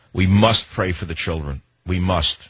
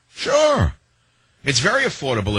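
sarcastic; 7 semitones higher than usual. Real, not acted. Sarcastic jab at health care.

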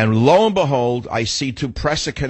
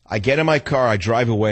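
ordinary delivery, nothing marked

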